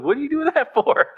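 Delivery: put-on high, indignant child's protest with a laugh; indignant toddler. Father imitates his toddler daughter protesting, high and indignant. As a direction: squeak it like a wronged two-year-old, laughing. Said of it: "and she's like".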